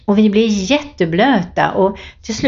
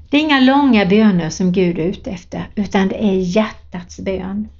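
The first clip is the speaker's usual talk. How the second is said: ordinary delivery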